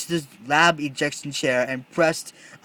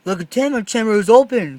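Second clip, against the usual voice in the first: loud, fast, higher alarm; loud fast panicked cry; 6 semitones higher, 9 dB louder, faster than usual. Acted. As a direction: shout the discovery in shock. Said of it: "cried Jimmy".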